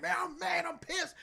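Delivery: high-pitched mock outrage; mock anger. Partner grumbling after covering for him, high mock anger. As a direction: squeak out fake fury.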